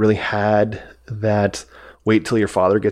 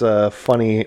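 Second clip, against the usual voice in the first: ordinary talk, nothing marked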